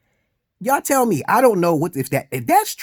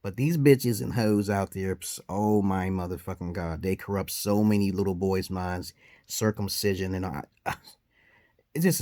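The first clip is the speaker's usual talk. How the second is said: usual speaking voice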